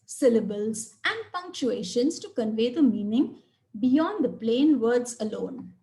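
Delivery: usual speaking voice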